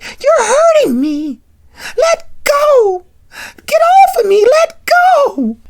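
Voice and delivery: very high, loud cries of pain; distressed scream. Retold or imitated. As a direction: act out a desperate plea to be released. Acted girlfriend grabbed, struggling to escape.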